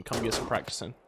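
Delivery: normal talking voice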